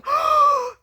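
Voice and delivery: high, loud astonished gasp; mom's shocked gasp. Retold or imitated. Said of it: "she was more shook than I was".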